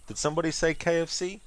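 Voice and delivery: high, sing-song ad line; the crook chirps the slogan brightly; cheery crook voice. Retold or imitated. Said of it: "And the crook's like".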